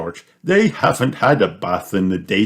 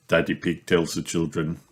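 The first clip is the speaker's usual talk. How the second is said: ordinary delivery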